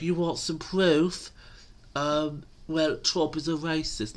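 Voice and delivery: high, slow mocking impression; Biden. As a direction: drag out a dim, stalling comeback. Mimics Biden answering the proof demand.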